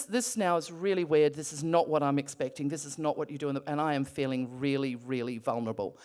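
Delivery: usual speaking voice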